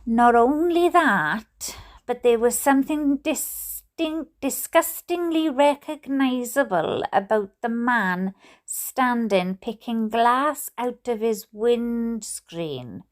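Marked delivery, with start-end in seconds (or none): none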